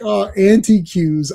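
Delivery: slow "antiques" drawn out as "an-tee-kyooz"; savoring antiques. Retold or imitated. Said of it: "words are said the wrong way".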